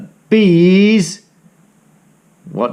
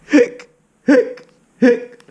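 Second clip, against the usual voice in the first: hiccups; 11 semitones higher than usual. Acted. Cat hiccuping all the way home.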